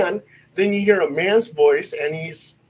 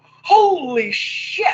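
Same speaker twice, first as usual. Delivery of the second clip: slow, high drawn-out astonishment; stunned; 11 semitones higher, much slower than usual. Retold or imitated. Investigator hearing the ghost say hello back, stunned. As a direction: stretch it out in high-pitched amazement.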